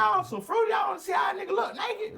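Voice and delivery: high, loud, fast jeering yell; mocking shout. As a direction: throw the insult at them. Yelling at guards.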